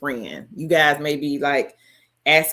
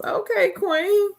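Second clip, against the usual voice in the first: excited; slower than usual. Real, not acted. Excited shoutout.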